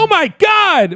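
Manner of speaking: mock scream. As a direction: imitate a panicked girlfriend in the car. Girlfriend screaming in car.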